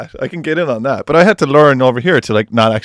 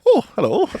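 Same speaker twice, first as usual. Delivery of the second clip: much higher, sing-out friendly greeting; chirpy; 11 semitones higher than usual. Retold or imitated. Answering a friendly stranger in L A, mock chirpy and high. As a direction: put on a sweet, chirpy hello. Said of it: "it's, like, really chirpy and nice".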